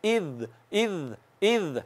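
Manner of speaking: इज़ quiet and slow, the ज़ without any hiss; soft z. Shown as a demonstration. Teacher shows a soft zal, quiet and slow. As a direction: breathe इज़ softly with no whistle. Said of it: "नरमी से".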